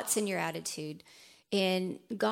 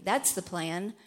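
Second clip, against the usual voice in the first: ordinary talk, nothing marked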